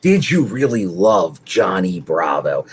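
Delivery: a hint of a lawyer drilling a witness; courtroom questioner. Lawyer drilling Rosemary on the stand, slow pointed questioning. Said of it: "He's drilling down, and he goes".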